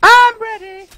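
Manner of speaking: high, loud hyped yell; excited shout. Real, not acted. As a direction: scream it like a fired-up fan. Answers hype question shouting.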